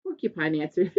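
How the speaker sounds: ordinary delivery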